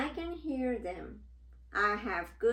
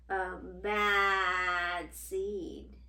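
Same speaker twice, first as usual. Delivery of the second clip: very slow, louder, menacing voice; sinister drawl; 4 dB louder, much slower than usual. Acted. Bad seed boasts menacingly to the children. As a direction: stretch it out like a villain.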